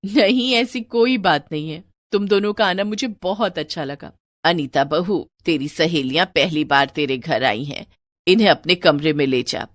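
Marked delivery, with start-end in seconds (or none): 4.4-9.7 put-on lower, older mother-in-law voice. Mother-in-law tells Anita to take her friends inside, lower elder voice. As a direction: speak as the household's elder woman.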